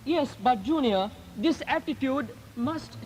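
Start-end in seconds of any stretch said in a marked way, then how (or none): none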